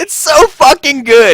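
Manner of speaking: delighted, loud and high; shouting with delight; excited. Real, not acted. Delighted at the intercom bit.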